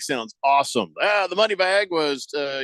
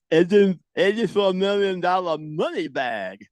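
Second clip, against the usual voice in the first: holding tongue with fingers; 4 semitones higher than usual. Shown as a demonstration. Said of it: "Now hold your tongue and say that".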